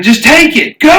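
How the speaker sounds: high, loud mock panic; retold shout. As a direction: shout it like a frantic driver. Retelling panicked driver shouting.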